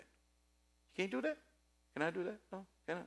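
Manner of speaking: playful rising doubt on you can't do that?; mock questioning. Shown as a demonstration. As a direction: tease the audience with a fake question.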